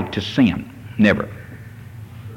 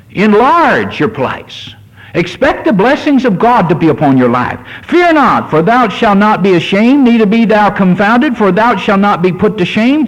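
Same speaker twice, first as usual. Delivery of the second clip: solemn King James reading, raised pitch; scripture reading; 5 semitones higher than usual. Acted. Preacher reading Isaiah to the church, solemn declaiming. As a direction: declaim the verses to the congregation.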